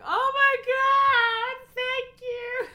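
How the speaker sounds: high, loud, slow, voice cracking with tears; half-laughing, half-crying gratitude; sobbing out a thank-you while laughing; tearful cry of thanks. Retold or imitated. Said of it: "And it was like".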